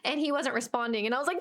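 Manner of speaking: ordinary talk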